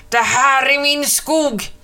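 witch mother yelling; a fairly mild shout at the eagle; hammed-up witch yelling "min skog"; mock yell. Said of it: "skrek hon".

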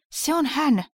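high, loud cry of recognition; startled outburst on spotting someone; urgent exclamation. A narrator's reading. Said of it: "mies huudahti ja juoksi ulos".

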